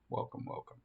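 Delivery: breathy whisper, trailing off; whispered echo. Acted. Echoing own welcome to subscribers.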